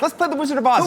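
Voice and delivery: put-on high, eager kid's invitation; excited kid. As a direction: pitch it up like a kid begging to play. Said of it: "I used to tell all my friends".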